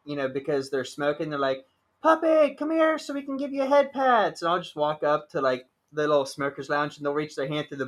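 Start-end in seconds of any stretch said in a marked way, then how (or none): 2.0-4.4 cooing puppy call. Retold or imitated. Drag queens calling to puppy.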